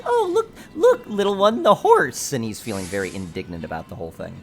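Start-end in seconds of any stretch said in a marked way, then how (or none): 0.0-2.3 high, loud delighted cry; excited passerby. Retold or imitated. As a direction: squeal at the horse like a passerby. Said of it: "being like".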